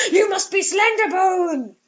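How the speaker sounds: gasp, then declare it like melodrama; campy horror-story revelation; gasping realization. Acted. Gasped dramatic horror realization.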